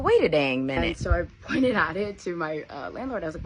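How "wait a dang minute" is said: surprised. Retold or imitated. Recognising the view.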